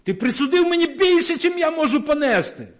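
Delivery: a rebel protesting his punishment; rebellious Cain. Retold or imitated. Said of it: "Каїн бунтує".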